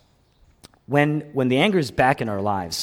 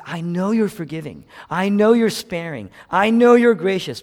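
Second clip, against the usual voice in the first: insistent complaint, climbing louder and higher; Jonah; 6 semitones higher, 6 dB louder than usual. Retold or imitated. Pastor voicing Jonah protesting to God, building indignation. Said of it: "Jonah says".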